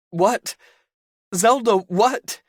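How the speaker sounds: high, loud panicked plea; desperate for the answer. Acted. Panicked question.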